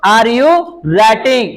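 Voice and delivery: each word slow and spaced; teacher spelling out a sentence; slow dictation. Shown as a demonstration. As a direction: dictate it slowly for the class. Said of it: "slow dictation of English".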